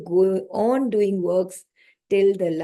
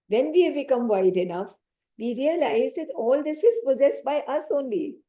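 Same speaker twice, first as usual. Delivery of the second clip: normal talking voice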